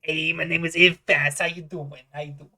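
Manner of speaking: loud improvised character greeting, easing off; friendly halfling bartender. Acted. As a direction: greet a customer as a folksy barkeep.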